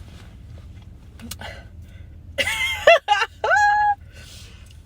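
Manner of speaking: very high squealing laugh; shrieking laugh. Real, not acted. Laughing to viewers about the shock, piercing squeal. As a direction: let out a piercing disbelieving laugh.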